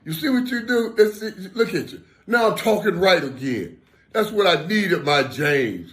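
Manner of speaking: high, loud and laughing through it; laughing delight. Retold or imitated. The friend laughing, voice restored, to James. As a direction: crow it out, laughing with joy. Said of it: "He said".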